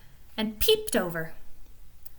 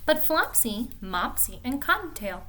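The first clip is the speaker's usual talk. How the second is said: a gentle read-aloud storytelling voice; storybook narration. A narrator's reading. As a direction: read it like a bedtime story for children. Reads Peter Rabbit aloud.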